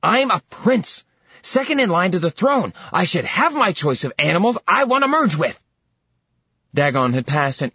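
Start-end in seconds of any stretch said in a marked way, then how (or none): 0.0-5.6 high, loud tantrum whine; petulant prince. Acted. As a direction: stamp your foot like a spoiled child. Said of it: "stomped his foot like a petulant child".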